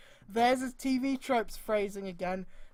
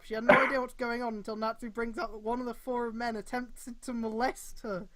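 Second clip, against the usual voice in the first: normal talking voice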